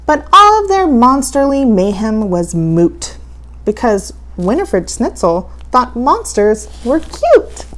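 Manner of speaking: ordinary delivery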